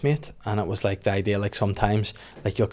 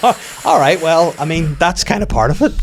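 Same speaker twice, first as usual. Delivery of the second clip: awkward comeback; 7 semitones higher, 7 dB louder than usual. Retold or imitated. Acting awkward reply to parents' criticism.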